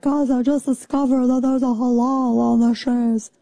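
mock discovery voice; imitating a woman. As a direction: play a shocked influencer. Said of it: "some chick was like".

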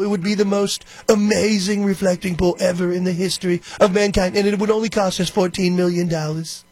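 sing-song boastful Trump hyperbole; hammed-up Trump bragging in a lilting voice; Trump impression. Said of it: "he just speaks in hyperbole constantly".

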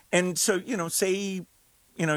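ordinary talk, nothing marked

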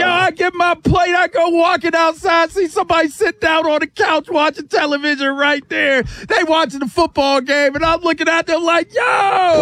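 high-pitched, escalating mock hype; hyped party routine. Party guest working the room, playfully hyped.

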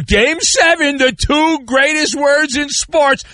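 high, drawn-out mock hype, turning scornful. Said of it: "I have to hear".

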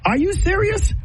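shocked, mocking disbelief; incredulous. Real, not acted. Speaker scoffs at "don't tell nobody," high incredulous. Mock disbelief at hush culture.

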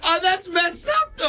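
shocked shout. Real, not acted. Shocked reaction to revenge.

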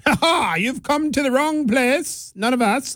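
high, slow, plummy royal voice; a Mayan turning the Frenchman away, posh and amused; mock British royalty. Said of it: "making the Incas and the Mayas sound like British royalty".

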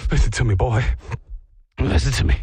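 low, loud movie-hero plea to the dog; deep and loud, hammed-up urgency; mock heroic command. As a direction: play it like a dramatic rescue film. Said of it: "being like".

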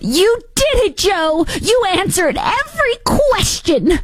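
very high, slow gushing mock wife voice; Jill impression. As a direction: coo proudly and slowly like a doting wife. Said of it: "you have Doctor Jill after the debate".

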